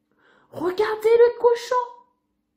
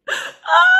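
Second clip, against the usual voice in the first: high loud cry of despair; hammed-up crying wail; mock wail; 10 semitones higher, 7 dB louder than usual. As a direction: burst into a wail. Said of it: "il commence à pleurer".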